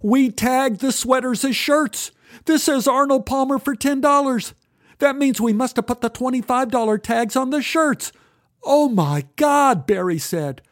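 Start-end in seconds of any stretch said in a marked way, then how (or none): 0.0-8.1 put-on high, anxious teen voice; panicked. Boy explaining the mistake, panicking. 8.6-9.9 high, slow dismayed gasp. Acted. Boy realising the blunder.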